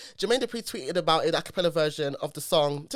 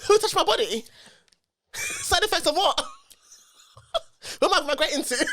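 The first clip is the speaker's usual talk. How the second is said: mock indignant questions; 10 semitones higher than usual. Real, not acted. Joking questions about lyrics.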